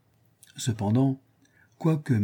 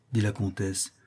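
plain reading voice

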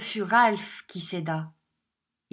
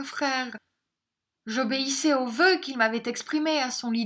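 higher, plaintive woman's voice; faltering; 6 semitones higher, 3 dB louder than usual. A narrator's reading. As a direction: plead in a failing, higher voice. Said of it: "d'une voix défaillante". (voix défaillante, aiguë et suppliante)

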